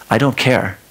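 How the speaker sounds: ordinary talk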